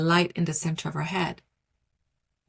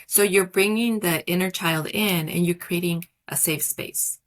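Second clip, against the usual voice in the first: normal talking voice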